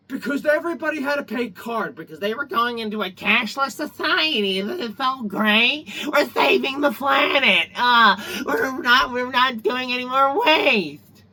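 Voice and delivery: sarcastic mock-cheerful parroting, high and loud; hammed-up sarcastic eco voice. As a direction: sneer it like a smug do-gooder. Mocking cashless eco talk.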